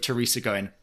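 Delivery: usual speaking voice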